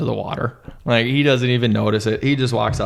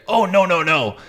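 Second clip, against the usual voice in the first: higher, light dismissive captain; Captain Ron brushing off; 9 semitones higher than usual. Retold or imitated. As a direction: wave it off casually. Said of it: "He's like".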